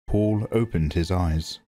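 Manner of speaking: usual speaking voice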